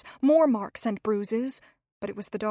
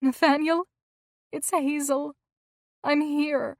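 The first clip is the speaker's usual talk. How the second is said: tender; 5 semitones higher, 4 dB louder than usual. A narrator's reading. Soothing dying husband.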